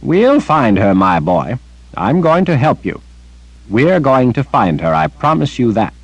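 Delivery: put-on old wizard's heartfelt promise; reassuring Zed. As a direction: promise him like a loving grandfather. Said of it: "Zed laid a bony hand".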